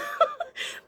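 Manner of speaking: loud, high squealing laugh; real delighted squeal of laughter; high burst of laughter. Real, not acted. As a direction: crack up in a shriek. Laughing at old man.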